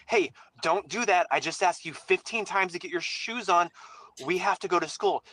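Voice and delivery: firm, exasperated parent laying down the law; stern parent voice. Retold or imitated. Parent telling the kids to get shoes on for school, sternly. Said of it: "I have a stern voice now".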